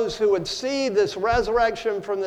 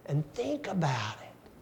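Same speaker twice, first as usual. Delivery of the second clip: hushed whisper; 4 semitones lower, 10 dB quieter than usual. Real, not acted. Preacher drops to a hush.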